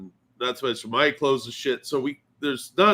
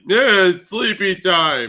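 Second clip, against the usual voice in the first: sleepy brush-off; 8 semitones higher, 9 dB louder, slower than usual. Retold or imitated. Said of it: "And I was like".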